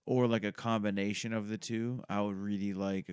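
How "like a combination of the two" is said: normal talking voice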